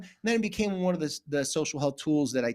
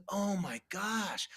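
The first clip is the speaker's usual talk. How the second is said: realization; 3 semitones higher, 8 dB quieter, much slower than usual. Retold or imitated. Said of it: "And I was like".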